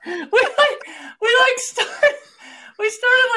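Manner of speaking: high, squealing laughter through the words; words breaking up with laughter; barely getting the words out for laughing; laughing hard. Real, not acted. Laughing fit while telling story.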